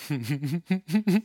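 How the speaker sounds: drawn-out run of fake little laughs; mock teasing chuckle. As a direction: imitate someone chuckling while they toy with you. Mimics Jesus toying with Peter.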